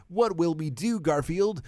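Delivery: worried Miranda. Acted. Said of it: "cried out Miranda with worrying".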